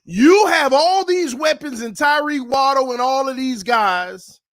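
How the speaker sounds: loud, incredulous sports rant; indignant. Real, not acted. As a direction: call the team out, a little upset. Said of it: "the one that makes me mad, a little upset".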